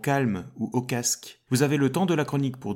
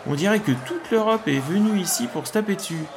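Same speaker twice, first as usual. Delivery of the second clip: high, shocked exclamations; startled by the noisy crowd; alarmed; 7 semitones higher than usual. Acted. (affolé, voix aiguë)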